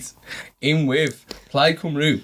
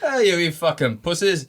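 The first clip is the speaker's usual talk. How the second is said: put-on Scottish accent; 4 semitones higher, slower than usual. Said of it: "another Scottish accent".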